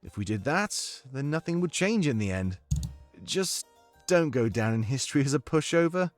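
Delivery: put-on approving British-accented adviser; Domik's comforting accent. Domik advises the new monarch on firm rule, British accent. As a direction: counsel in a measured British accent. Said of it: "I need your comforting accent right now".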